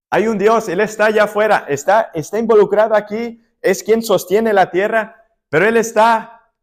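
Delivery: preacher pressing on the eternal consequences of sin; preaching at full force. Acted. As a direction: drive the point home to the congregation.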